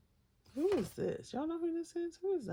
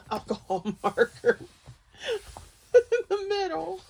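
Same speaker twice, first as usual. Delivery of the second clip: words squeezed out between laughs; laughing through it; 12 dB louder than usual. Real, not acted. Laughing at her own marker slip, giggling through the words. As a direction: crack up mid-sentence, pitch jumping high.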